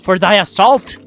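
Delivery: loud, high-pitched playful alarm; startled, mock-indignant yelp; startled outcry. Real, not acted. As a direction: cry out as if caught off guard. Caught off guard by game attack.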